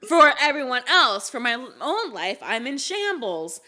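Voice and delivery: high-pitched comic confession; self-mocking. Real, not acted. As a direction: throw it out high, poking fun at yourself. Jokes about own messy life.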